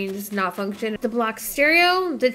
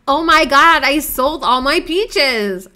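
much louder alarmed outburst, second line slow; dismayed surprise; 3 semitones higher, 8 dB louder, slower than usual. Real, not acted. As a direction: blurt out the realization in alarm. Realizes she sold the peaches.

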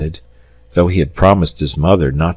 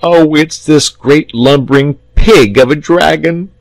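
put-on high, loud, aggrieved little boy; acted childish sob; sobbing; 9 semitones higher, 4 dB louder than usual. A narrator's reading. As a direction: wail the complaint like a tearful child. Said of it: "sobbed the boy".

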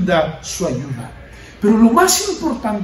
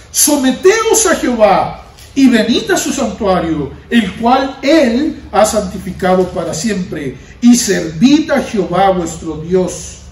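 slow, louder, weighty scripture reading; solemn scripture reading; 2 semitones lower, 3 dB louder than usual. Acted. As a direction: proclaim the verse slowly and firmly. (lectura solemne y lenta de la Escritura)

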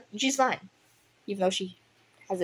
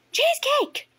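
high, loud call across the yard; 5 dB louder, slower than usual. Acted. Echo Shine calls loudly for Cheesecake over the fence. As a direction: shout the name to fetch her. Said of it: "Echo Shine called".

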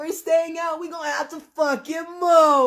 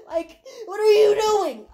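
higher, exasperated demand; exasperated plea; 5 semitones higher than usual. Retold or imitated. Her retold prayer demanding God act, exasperated plea. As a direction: plead upward in disbelief.

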